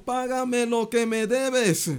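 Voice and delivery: high, slow choking demand. A narrator's reading. Servant strangles his fellow servant, demanding payment harshly. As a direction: squeeze the debtor for the money. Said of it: "le ahogaba diciendo".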